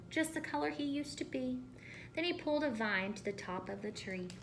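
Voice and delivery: usual speaking voice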